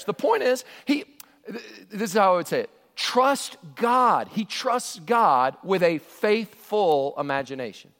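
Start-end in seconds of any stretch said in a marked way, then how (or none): none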